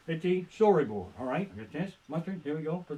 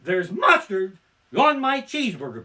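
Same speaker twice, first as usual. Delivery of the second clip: slamming complaint; 4 semitones higher, 8 dB louder than usual. Shown as a demonstration. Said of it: "you slam that fucker down".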